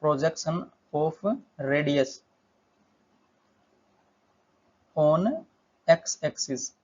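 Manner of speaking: very slow, long gaps between words; slow board-writing pace; dictating while writing. Real, not acted. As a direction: spell out the phrase chunk by chunk. Saying words while writing them out.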